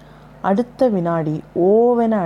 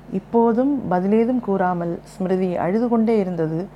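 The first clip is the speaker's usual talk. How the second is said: plain reading voice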